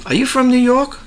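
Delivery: high-pitched imitated question; curious stranger. Retold question from restaurant staff.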